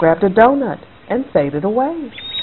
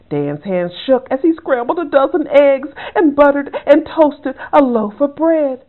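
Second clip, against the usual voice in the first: ordinary delivery, nothing marked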